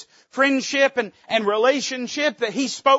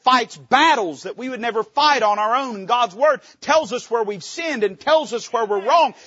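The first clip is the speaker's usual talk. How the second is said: normal talking voice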